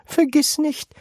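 put-on high old woman, kindly; old grandma voice. A narrator's reading. Grandma Georgina comforts Charlie in a high old voice. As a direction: pitch up into a granny voice.

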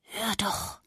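breathy hush, very soft; grandmother's urgent whisper; grandma whispering. Acted. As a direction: shush the room like a careful grandma. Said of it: "flüsterte die Oma".

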